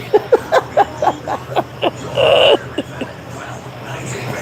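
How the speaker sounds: very high, breathless silent laugh; long wheezing laugh. Real, not acted. Streamer cracking up at his own joke, wheezing. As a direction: cackle without voice.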